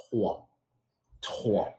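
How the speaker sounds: "trois" with a French guttural R; throaty French R. Shown as a demonstration. Teaching students three in French, throaty R. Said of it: "Use your throat".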